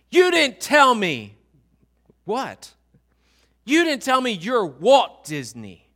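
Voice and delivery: hammed-up little girl accusing her dad; mock accusing child.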